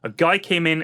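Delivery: ordinary talk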